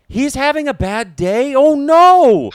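high, loud worried cry; imitating Peter's alarm. To the friend, alarmed that Greg is having a bad day. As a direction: gasp with worry for him. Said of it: "he goes".

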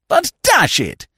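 high, drawn-out outburst; spluttering young club man; exasperated protest. Acted. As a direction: protest helplessly in outrage.

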